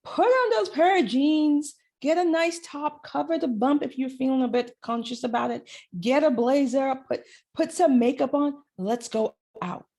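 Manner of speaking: lightly played cheerleading encouragement; upbeat inner cheerleader. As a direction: hype yourself up like a cheerleader. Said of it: "She's the one going".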